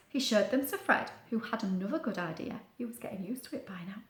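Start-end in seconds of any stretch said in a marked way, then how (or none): 2.8-4.0 fast whispered aside. A narrator's reading. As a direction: let the children in on a secret. Whispered joke aside to children.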